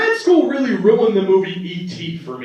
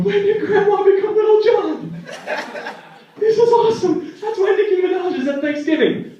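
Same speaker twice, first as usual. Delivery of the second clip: delighted grandkids; 13 semitones higher than usual. Retold or imitated. Excited grandkids' reaction.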